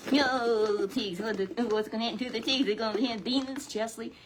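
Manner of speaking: high, fast alarmed puppet. Acted. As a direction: puppet panics about the pigs.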